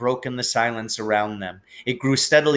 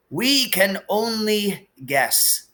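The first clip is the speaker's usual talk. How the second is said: higher, slow and measured; gentle sorrowful old wizard; wise old headmaster, lightly voiced; 9 semitones higher, much slower than usual. A narrator's reading. Dumbledore figure held.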